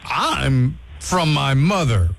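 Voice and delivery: ordinary delivery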